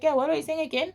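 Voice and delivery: high-pitched imitation of a rude retort; mock rude phone voice. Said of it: "in a very rude way".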